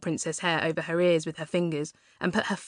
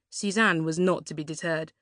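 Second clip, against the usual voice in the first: plain reading voice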